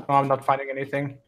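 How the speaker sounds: ordinary delivery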